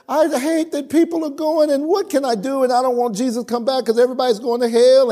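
high upset woman, rushing at the end; distressed lady. Retold or imitated. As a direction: play a lady pouring out her fear. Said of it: "she just broke down".

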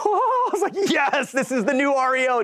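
high, laughing with sudden delight. Retold or imitated. Agent realizing hedge funds buy hundreds, laughing high. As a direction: squeal it out through a laugh.

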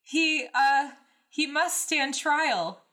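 high, loud, then slow insisting; desperate yell. A narrator's reading. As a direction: stumble then insist hard. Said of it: "Anakin yelled desperately".

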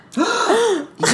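sharp high gasp; excited gasp. Real, not acted. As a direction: gasp in delighted surprise. Reacts to Mother mention.

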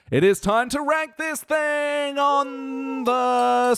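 high, slow, drawn-out game-show announcement; announcer. Pretend. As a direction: belt out a segment intro, stretching the words. Host announces the ranking segment.

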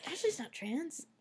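retold confusion, softly to yourself; quietly thinking it through, bewildered; baffled inner voice. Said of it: "sitting there like".

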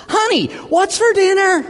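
very high-pitched mock scream. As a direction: yell it right behind her, hammed up. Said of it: "walks right up behind her and screams".

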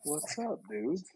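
faint and far from the mic; too quiet, off mic; barely audible. Real, not acted. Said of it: "I can barely hear you".

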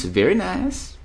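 "Very nice" jumped up high. Pretend. Echoing a chat fan of Aussie accents, high and playful.

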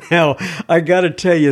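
ordinary talk, nothing marked

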